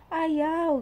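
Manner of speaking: put-on child's voice. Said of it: "Tapos sabi niya".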